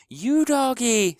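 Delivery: put-on high, loud, drawn-out child's scream; little girl screaming for her dog. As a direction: shriek after the runaway dog. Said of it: "screamed Honey".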